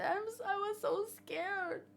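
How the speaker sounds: high, soft tearful whimper; acted crying. Rescued woman telling Ray she was so scared, crying and high. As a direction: whimper it through put-on tears. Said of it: "And they're crying".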